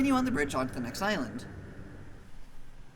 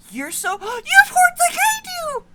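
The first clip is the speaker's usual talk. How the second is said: much higher, loud gasping squeal; excited squeal; 10 dB louder, slower than usual. Real, not acted. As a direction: shriek with delight at the horns. Player squeals at horned monster.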